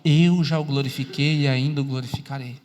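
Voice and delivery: low-pitched, measured voice of God; clear voice. Acted. God's words passed on to others, clear low voice. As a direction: relay it low and clearly, without booming. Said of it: "vão ouvir uma voz clara".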